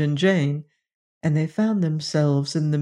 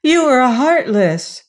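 put-on higher, louder accusing girl; higher, louder accusation; Jane; 7 semitones higher, 6 dB louder than usual. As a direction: accuse the dwarfs tearfully. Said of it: "Jane stopped crying to say".

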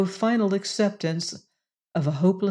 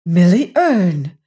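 wondering cry; 8 dB louder than usual. A narrator's reading. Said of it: "she cried wonderingly".

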